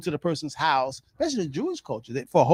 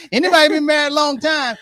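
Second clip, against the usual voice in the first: laughing shout; 10 semitones higher, 9 dB louder than usual. Real, not acted. Laughing about long marriage.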